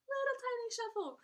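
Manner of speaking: very high, squeaky little voice; playful high-pitched miniature voice; tiny voice. Pretend.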